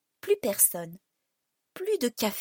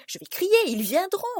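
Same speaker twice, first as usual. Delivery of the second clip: higher, urgent resolve; narrated panic, raised voice; rising panic; 8 semitones higher, 4 dB louder than usual. A narrator's reading. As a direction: talk yourself into calling for help, pitch up. Panicked resolve to cry for help.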